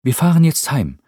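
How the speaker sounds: viel höher, lauter, fröhlich drängend; junger Cameron, munter. Acted. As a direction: heller junger Mann, der Rupert aufmuntert. Cameron voiced by narrator. (hohe, lebhafte Jungenstimme)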